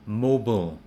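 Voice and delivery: American mobile as 'MO-bul'; American pronunciation. Shown as a demonstration. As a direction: reduce mobile's ending to 'bul'. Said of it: "in the American accent".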